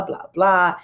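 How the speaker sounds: ordinary talk, nothing marked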